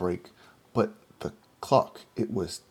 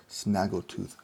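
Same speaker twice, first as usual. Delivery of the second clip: ordinary delivery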